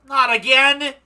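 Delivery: loud, high outcry of dismay; exasperated shout. Acted. Startled game character reacts to a wax figure, exasperated shout.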